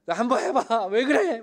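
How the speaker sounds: high, loud, laughing mock scolding; mock angry challenge. Sarah daring God to try it, laughing mock anger. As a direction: dare God, half laughing. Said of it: "막 이러지".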